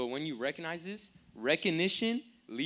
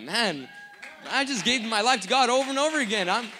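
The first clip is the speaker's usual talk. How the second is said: much higher, louder rejoicing; exultant testimony; 10 semitones higher, 8 dB louder than usual. Acted. New believer rejoicing back home after repenting, exultant shout. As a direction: proclaim it, overjoyed to be forgiven.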